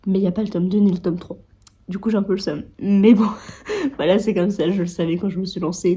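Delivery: ordinary talk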